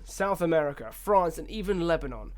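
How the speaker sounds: usual speaking voice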